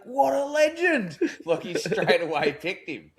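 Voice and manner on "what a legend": high, slow delighted quote; stoked mate, pitched up and drawn out; mate's reaction. Retold or imitated. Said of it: "He's like".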